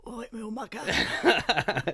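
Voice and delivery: put-on slow, loud laughing Cockney accent. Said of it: "repeat your Cockney accent".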